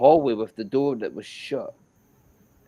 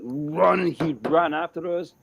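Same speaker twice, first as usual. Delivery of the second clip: plain reading voice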